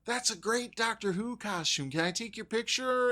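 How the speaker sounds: high, put-on fan voice. Excited fan asking for a photo, high and gushing. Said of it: "someone said".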